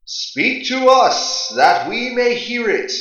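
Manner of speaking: high, slow, ringing chorus of gods; a lifted, drawn-out plea from the gods. Acted. Gods speak to Ra.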